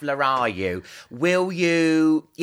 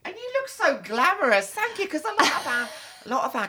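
camp air steward; 12 semitones higher, faster than usual. Pretend. Flight attendant role play.

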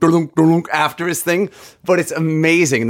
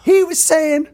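[name] rant. Retold or imitated. Mimicking a rock frontman hyping the arena crowd, high shouted. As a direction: whip the crowd up like a rock star. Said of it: "he's just like".

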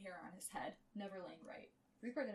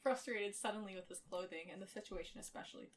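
normal talking voice

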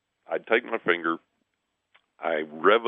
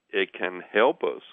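normal talking voice

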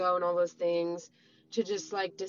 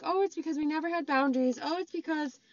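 higher-pitched imitation of an excuse; put-on higher voice for quoted excuses; mock excuse-making; 7 semitones higher than usual. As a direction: lightly parody someone offering excuses, pitched up. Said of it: "it was still framed as, like".

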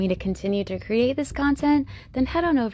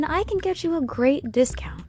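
normal talking voice

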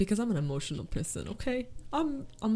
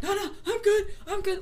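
high, squeaky mock reassurance; tearful denial; 13 semitones higher than usual. Crier waving off concern, strained high denial. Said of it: "And then I'm like".

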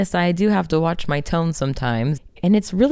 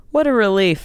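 sarcastic; 6 semitones higher, 4 dB louder than usual. Retold or imitated. Sarcastic aside.

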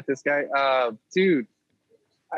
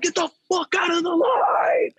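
mock furious yell. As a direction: yell it like a clerk who has had enough. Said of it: "zero to negative patience".

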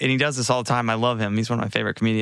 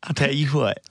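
Southern drawl. Pretend. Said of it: "I'm only talking like this from now on".

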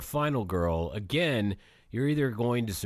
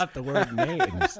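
laughing; 9 semitones higher, 4 dB louder, slower than usual. Real, not acted. Mocking correction with laugh.

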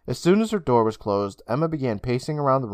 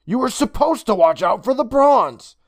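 put-on higher, louder girl's voice; demanding girl; 11 semitones higher, 6 dB louder than usual. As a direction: tell him off for leaving his post. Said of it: "she demanded".

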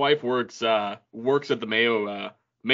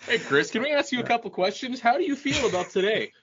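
high-pitched mock TV reporter; put-on interview voice, high; mock interviewer; 8 semitones higher than usual. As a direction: play an eager interviewer putting questions, pitched well up. Mock interview of the turtle kid.

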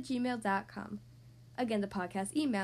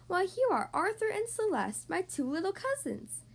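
cried in amazement; 6 semitones higher than usual. Acted. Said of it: "he cried in amazement".

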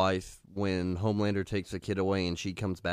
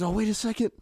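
mock surprised voice; 13 semitones higher, faster than usual. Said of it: "He's like".

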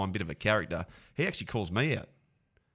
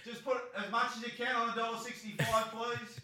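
mock eager punter; 13 semitones higher, 5 dB quieter than usual. Jokingly asks host to bet.

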